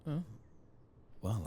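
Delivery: ordinary delivery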